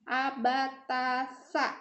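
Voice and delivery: "Abatasa" very slowly, tongue near the teeth on sa; slow teacher drill; slow spelled drill. Shown as a demonstration. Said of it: "Jadi lidahnya di dekat gigi".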